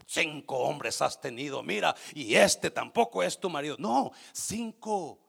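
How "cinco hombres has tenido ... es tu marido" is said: low harsh accuser, rising to a louder scold; mock accusing voice. Jesus as he did not speak to the woman, a mock scold. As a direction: lightly play the condemning judge. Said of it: "No le dijo".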